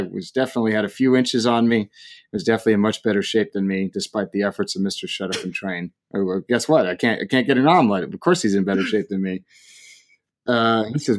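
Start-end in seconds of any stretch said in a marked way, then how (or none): none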